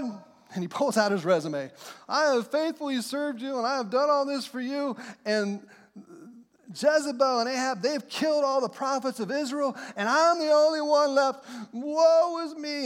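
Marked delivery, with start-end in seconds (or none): 2.1-12.8 high, whining self-pity that climbs to a wail; pity party whine. Retold or imitated. Elijah whines to God that he is the last prophet left. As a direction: ham up the martyr, slowing on woe is me. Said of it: "doing the whole pity party".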